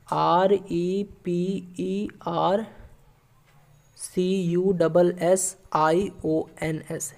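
letters called slowly with pauses; spelling out slowly. Shown as a demonstration. Teacher spelling out a corrected word for students. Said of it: "इसको कैसे लिखिएगा?".

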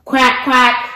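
playful duck noise; duck quack. Acted.

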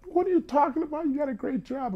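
very high, loud mock-wailing mother; crying Italian mother. Italian mother hears son fled to Vegas, mock crying. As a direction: imitate a distraught mom, shooting way up high. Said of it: "this Italian mother who's, like, crying".

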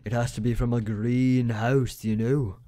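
a bad Shrek impression, pushed harder; self-mocking fake Scottish brogue; Shrek's Scottish accent. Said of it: "that's a horrible accent".

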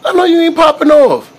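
high, loud mock outrage at a baby; mock indignation. Adult play-arguing with a babbling baby, mock indignant. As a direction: pitch it up loud, playfully affronted.

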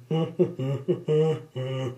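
high teasing laugh. Acted. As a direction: laugh at him.

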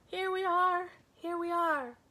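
put-on high, loud chorus of little children; babies' voices. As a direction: squeal it back like a crowd of babies. Babies answer the ogre.

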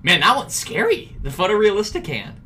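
high, loud startled outburst; a real jolt of fright at the screen; spooked yelp of disbelief; scared. Real, not acted. Startled by creepy game hand.

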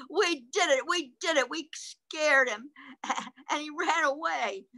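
high-pitched gleeful little rabbit; Rabbit's triumphant cry. Acted. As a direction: celebrate in an excited high voice. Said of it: "cried Rabbit".

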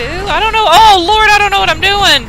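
high, loud panicked wail; frantic high-pitched fluster. Real, not acted. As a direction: squeal it out, totally lost. Panicking at the game.

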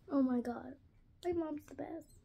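usual speaking voice

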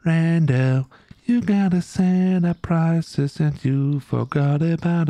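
slow, high, stretched-out reminder; mock nagging. Streamer plays chat reminding them about prices, slow high nagging. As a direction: drag out each word as a high mock nag.

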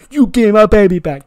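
high, very loud yell; acted angry demand. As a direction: shout it while chasing a thief. Truth chasing champion, acted demand.

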